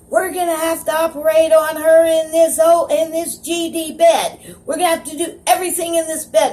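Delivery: high, tense imitated command; urgent doctor. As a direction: call out orders like a doctor in an emergency. Retold doctor taking urgent command.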